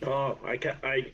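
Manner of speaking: ordinary talk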